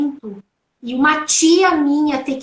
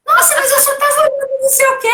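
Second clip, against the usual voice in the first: mock husband excuse; 11 semitones higher, 3 dB louder, faster than usual. Marido se defendendo, citado.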